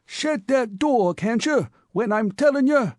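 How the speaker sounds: put-on old cabman losing patience with the Bowery youth; sharp and angry. A narrator's reading. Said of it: "said the mummy with increased asperity".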